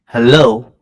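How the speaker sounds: loud hello into the mic; calling out. Real, not acted. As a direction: call out to check the line. Calling out over bad connection.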